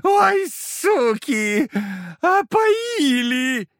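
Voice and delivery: very slow, high drawn-out wail; wailing devil. Acted. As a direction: moan like a poisoned demon.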